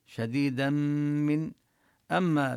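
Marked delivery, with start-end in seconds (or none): 0.0-1.5 slow شديدا من with a long nasal hum into the mim; nasal merge. Shown as a demonstration. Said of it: "حكمه حكم الإدغام بغنة".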